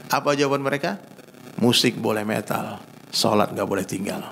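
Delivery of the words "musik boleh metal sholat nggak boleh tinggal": unhurried, low-voiced counsel; calm gentle answer. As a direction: reassure like a kindly teacher, imitating. Retold gentle answer of kind preachers.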